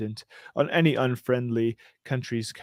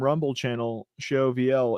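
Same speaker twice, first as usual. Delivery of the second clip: ordinary delivery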